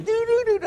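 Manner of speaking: high sung ding-dong of a doorbell; mimicking the doorbell ringing at 2 am; doorbell imitation. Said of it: "the doorbell's ringing".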